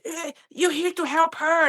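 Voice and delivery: high, mocking indignant voice; man sneering at paramedic in her face, mocking; aggressive man quoted. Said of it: "he's just like".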